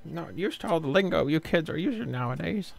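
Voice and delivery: put-on high, frail old lady voice; grandma voice. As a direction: talk like a grandma. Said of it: "That's my grandma voice".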